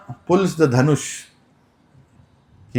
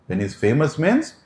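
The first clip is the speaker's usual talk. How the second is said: normal talking voice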